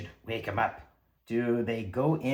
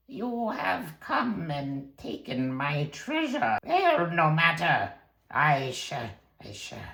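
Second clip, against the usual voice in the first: slow, drawn-out monster voice, dying away at the end; undead wizard; 5 semitones higher than usual. Acted. Risen wizard confronting thieves over treasure, slow and menacing. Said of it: "walks towards them, just like".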